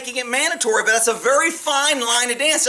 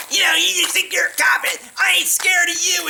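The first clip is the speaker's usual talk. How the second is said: higher, mocking shout in another voice; nasty taunting; 7 semitones higher than usual. Neighbor taunting him from the mailbox, nasty mock shout. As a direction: sneer it at him all the way home. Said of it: "being a total meh, meh".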